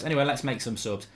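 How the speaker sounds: ordinary talk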